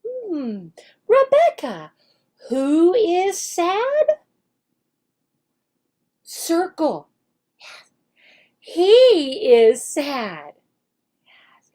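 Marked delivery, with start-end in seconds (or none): 1.1-4.2 higher, slow questioning teacher voice; teacher questioning child. Shown as a demonstration. Teacher asks the student by name, high and slow. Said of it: "teacher acts out the lesson". 8.6-10.5 slow modeled sentence. Shown as a demonstration. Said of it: "teacher acts out the lesson".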